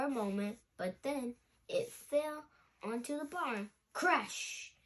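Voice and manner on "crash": ordinary delivery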